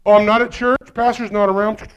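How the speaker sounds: ordinary delivery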